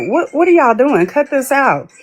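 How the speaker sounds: mock alarmed order to stop; retold alarm. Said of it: "And I'm like".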